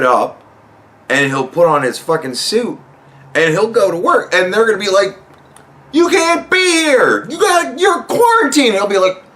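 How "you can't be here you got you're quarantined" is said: mock staffer. Staff stopping Trump at work.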